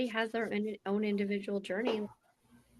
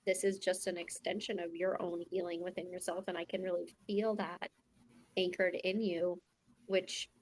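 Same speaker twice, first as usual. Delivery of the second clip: normal talking voice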